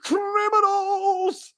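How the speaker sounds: very high, loud and stretched out; tangled official wailing drawn-out cries; drawn-out shriek. Acted. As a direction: wail each word long.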